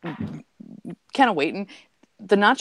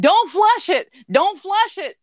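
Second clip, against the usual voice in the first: high, loud cry for help; panicked yell; 13 semitones higher, 5 dB louder, slower than usual. Retold or imitated. Drowning trucker yells at the bartender, high panicked shout. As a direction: shout it while going under.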